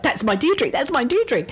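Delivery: mildly insistent retold claim, repeated; insistent spirit. Spirit lady claiming her daughter at the psychic's door, mildly insistent. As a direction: lightly imitate someone pressing her claim. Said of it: "was so insistent".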